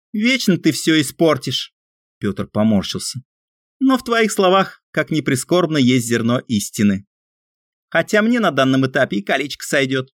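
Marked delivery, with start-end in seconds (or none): 0.1-1.7 slow, high, put-out complaint; grumbling friend. A narrator's reading. Annoyed friend voiced. 3.8-10.1 grudging friend. A narrator's reading. Friend concedes, voiced high.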